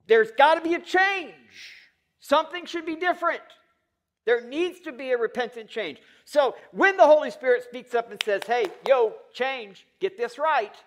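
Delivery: loud, high, impassioned sermon delivery; full-force preaching. Acted. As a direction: preach it hard, driving each demand home.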